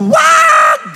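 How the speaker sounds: very high, loud drawn-out shout; shouted command. Real, not acted. Preacher forbids worry and fear, shouted command. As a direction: cut off their worrying with one yell.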